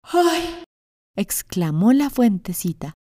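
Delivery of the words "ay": much higher startled ay; a small shocked gasp-word; fountain's cry. Acted. Fountain exclaims.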